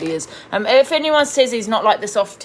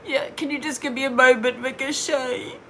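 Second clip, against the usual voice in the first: high, whiny mock-upset voice, much higher; mocking fake crying; 8 semitones higher than usual. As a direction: imitate her fake tears with scorn. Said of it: "Pretending to cry".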